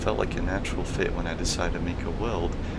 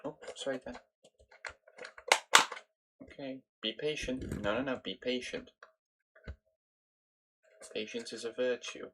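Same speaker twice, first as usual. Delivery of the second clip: quiet, higher coaxing; soft pet talk; 6 semitones higher, 11 dB quieter than usual. Real, not acted. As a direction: gently urge the dog on. Quietly coaxing dog to be patient.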